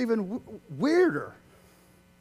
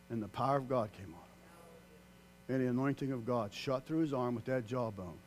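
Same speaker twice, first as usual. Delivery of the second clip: hushed; 7 semitones lower, 8 dB quieter than usual. Real, not acted. Hushed retelling of the battle.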